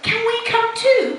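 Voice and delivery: high-pitched eager question; eager Peppa. Acted. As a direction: pipe up hopefully, asking to join in. Peppa asks eagerly in story.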